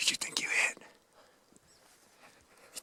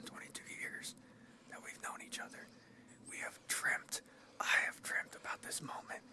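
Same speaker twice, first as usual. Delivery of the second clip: whispering; 5 dB quieter than usual. Real, not acted. Whispering on a hunt.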